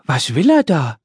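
pert little man's voice, lightly pinched; the nosy little creature in her ear; little pink man. Acted. Little pink man asks behind Brix's ear, nosy.

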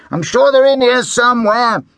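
high, loud dad voice. A narrator's reading. Dad rummaging in crate.

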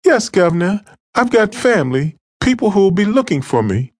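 put-on high, pleading young woman's voice; cornered girl tells her captor her family will look for her; frightened girl. Captive girl pleads with attacker.

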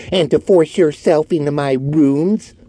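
high, resentful sarcasm; sarcastic. Acted. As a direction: press the grievance sarcastically. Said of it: "continued Orton sarcastically".

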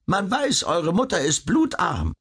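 high-voiced old skinflint; miserly, high-pitched objection. A narrator's reading. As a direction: speak high, as a grudging little old man. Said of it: "ein echter und rechter Geizhals".

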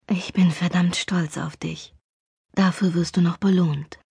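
soft, low murmur close to the ear; whispered into ear. A narrator's reading. Chris murmuring praise into his girlfriend's ear, hushed and low. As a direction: breathe it softly into her neck. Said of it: "flüsterte in mein Ohr".

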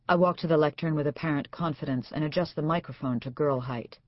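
usual speaking voice

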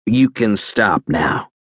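ordinary delivery

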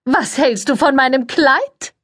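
very high, sugary society voice; gushing press lady. A narrator's reading. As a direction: coo it, all air kisses and fuss. Cordelia voiced in story.

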